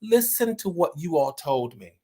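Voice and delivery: usual speaking voice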